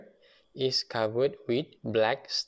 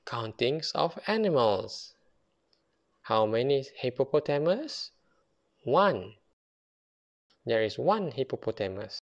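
ordinary delivery, nothing marked

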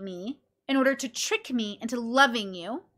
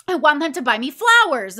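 loud, higher mock complaint; mock insistence; 4 semitones higher, 8 dB louder than usual. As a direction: imitate a friend insisting, louder. Retold friend insists in an argument.

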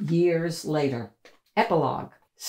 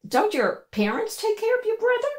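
put-on higher girlish voice, curious; young girl voice. A narrator's reading. Sophie asking the trash-carrying girl, high girlish voice. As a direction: ask like a puzzled little girl.

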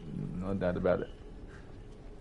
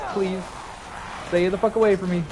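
ordinary delivery

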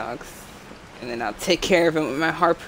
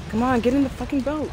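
urgent; 6 semitones higher, faster than usual. Real, not acted. Urgent escape.

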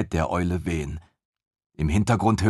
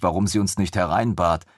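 ordinary delivery, nothing marked

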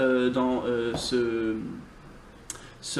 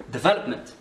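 "development" with the second syllable VEL stressed. Shown as a demonstration. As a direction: hit VEL in "development", rest light. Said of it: "on accentue le V". (accentué sur « vel » dans « development »)

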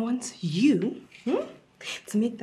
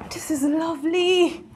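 usual speaking voice

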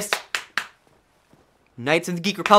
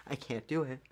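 quiet defeat; 10 dB quieter than usual. Real, not acted. Defeated repeat.

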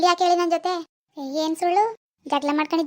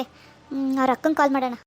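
ordinary talk

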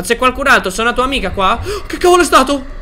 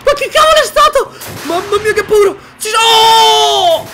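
frightened shouts; 8 semitones higher, slower than usual. Real, not acted. Gamer jumping at a monster, scared shouting. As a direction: yelp in real fright.

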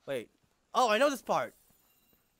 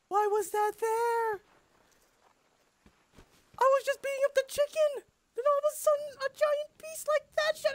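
shocked disbelief; 12 semitones higher than usual. Real, not acted. Shocked by sudden dragon.

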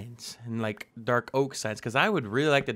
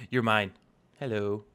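ordinary delivery

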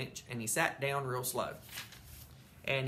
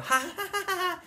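high taunting ha ha ha; mocking laugh; 4 dB louder than usual. May laughing at Sheldon's empty basket, mocking laugh. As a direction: laugh right at him.

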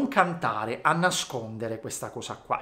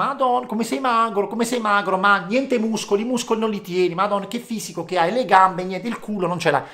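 higher, mocking voice of teasers; 7 semitones higher, 5 dB louder than usual. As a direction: tease a skinny kid. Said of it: "gli altri mi dicevano". (presa in giro, voce più acuta)

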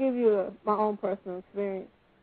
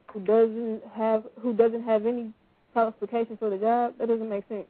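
usual speaking voice

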